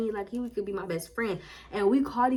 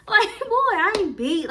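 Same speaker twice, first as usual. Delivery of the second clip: sassy brag; 10 semitones higher, 8 dB louder than usual. Retold or imitated. Showing off her look at mall.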